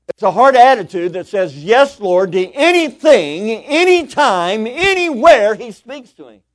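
high, very loud pulpit climax; full-force, drawn-out proclamation; shouted preaching. Real, not acted. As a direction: shout it out, stretching each key word. Preacher shouts the key point.

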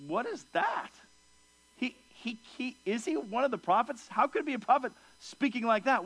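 high, incredulous stammering questions; can't believe what you're hearing; baffled disbelief. Retold or imitated. Onlookers stunned by Saul's prophesying, in incredulous disbelief.

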